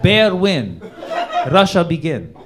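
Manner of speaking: loud, slow broken-English Russian narration; mock Putin screenplay. Comic mocks Putin's history lecture, Russian-accented narration. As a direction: read it like a pompous Russian screenplay. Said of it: "He's like".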